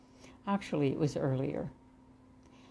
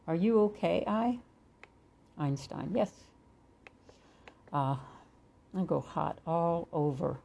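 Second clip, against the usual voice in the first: guided meditation. Acted. Reads a channeled meditation dialogue.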